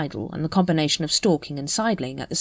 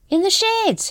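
high, choked wheeze from smoke; wheezing; 3 dB louder, slower than usual. A narrator's reading. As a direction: gasp it out after choking on smoke. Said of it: "he wheezed".

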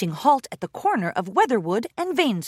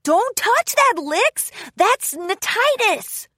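high, loud urgent cry, slow on the name; urgent warning; 12 semitones higher, 4 dB louder, slower than usual. Acted. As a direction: stop a friend in a hurry. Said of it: "Rainbow Dash urged".

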